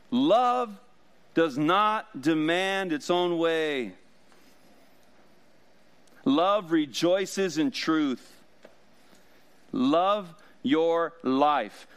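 slow, emphatic preaching, each line weighty. Acted. Pastor proclaiming to the congregation, slow emphatic preaching. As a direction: proclaim it slowly from the pulpit.

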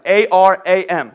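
A R A M spelled letter by letter, slowly; slow spelling of the name. Shown as a demonstration. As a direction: spell Aram out one letter at a time. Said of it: "I think that's how you say it".

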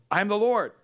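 loud, high proclamation; divine declaration. Acted. God's message to the people of Israel, loud proclamation. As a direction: ring it out as God's own words.

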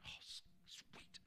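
whispered inner thought, slow. Retold or imitated. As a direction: breathe it out like a private thought. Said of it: "Which inside I'm going".

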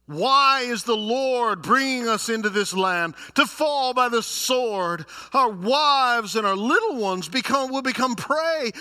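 loud, high wail of complaint; hammed-up protest, high and loud; whining. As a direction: cry out in mock despair at an unfair fate. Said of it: "these people are the biggest whiners".

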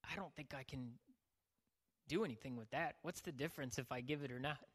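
soft, discouraged self-talk; doubtful, deflated. Acted. Would-be giver faces a huge need, quiet doubt. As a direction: drop the voice as if giving up.

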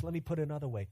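ordinary talk

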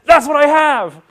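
high, loud rueful recognition; loud mock self-diagnosis; recognizing kid. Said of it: "And he says".

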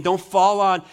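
normal talking voice

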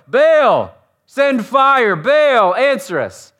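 loud, high pleading shout; yelling to a god. Retold or imitated. Prophets calling on Baal for fire, yelling. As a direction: cry out for an answer from above. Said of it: "So they yell louder".

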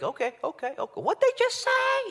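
mock alarm, voice shooting up; startled Holy Spirit. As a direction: caught off guard by what he just heard. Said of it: "You're like".

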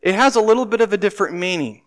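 ordinary talk

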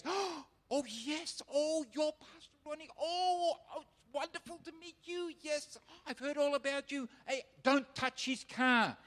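an officer melting into flattery; fawning colonel. Retold or imitated. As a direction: colonel gushing over Pastor Ronnie. Colonel suddenly fawning over pastor.

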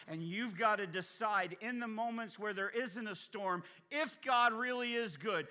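ordinary talk

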